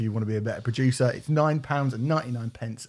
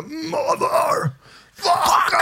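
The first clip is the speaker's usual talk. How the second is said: shouted; 3 dB louder, much slower than usual. Pretend. Asks about the concert scream.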